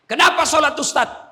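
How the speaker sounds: high, loud rhetorical cry; insistent question. Acted. Listener asking the preacher why prayer matters, insistent question. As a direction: call it out like a challenge from the crowd.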